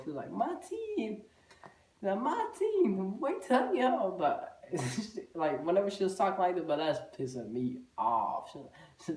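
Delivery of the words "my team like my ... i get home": high mocking imitation of a mother's accent; mocking the mom's African accent. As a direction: squeal it like a girl aping his mom. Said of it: "making an impression of my mom's voice".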